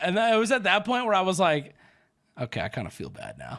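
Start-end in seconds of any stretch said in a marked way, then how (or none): 2.4-3.6 much lower and softer, sheepish admission. Retold or imitated. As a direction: mumble it with a twinge of guilt. Regret after insulting clerk.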